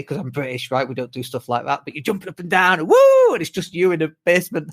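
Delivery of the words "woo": high, loud "woo"; big high-pitched party whoop; cheering whoop. Acted. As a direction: whoop it up like a crowd at a live event. Acting excited cheering at event.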